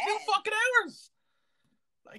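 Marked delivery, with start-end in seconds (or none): 0.1-1.1 high, stunned outrage; can't believe it; outraged disbelief. Real, not acted. Outraged repeat.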